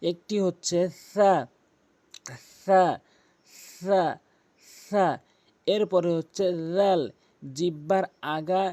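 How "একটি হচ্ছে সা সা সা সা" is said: সা said with the tongue tip against the teeth tips, like th in think; সা with a voiceless th sound, not a plain s; Arabic letter sa demo. Shown as a demonstration. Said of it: "তিনটি হরফ উচ্চারণ করতে হয়".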